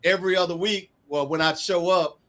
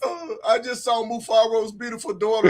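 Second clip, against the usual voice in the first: retold crying; 4 semitones higher, 4 dB louder, slower than usual. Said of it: "she calls me crying".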